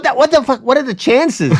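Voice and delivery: high-pitched exasperated disbelief; incredulous. Retold or imitated. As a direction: marvel in panic at rotten luck. Teller's alarm at unlucky coincidence.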